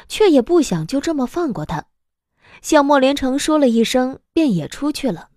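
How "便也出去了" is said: ordinary talk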